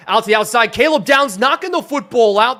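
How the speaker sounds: loud, high-pitched commentary; excited play call. Real, not acted. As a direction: shout the big defensive play as it happens. Live call of a big defensive play.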